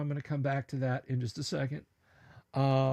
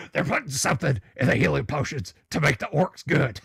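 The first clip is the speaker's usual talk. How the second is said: Alex Jones voice; 4 dB louder than usual. Acted. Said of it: "I don't bust out the Alex Jones".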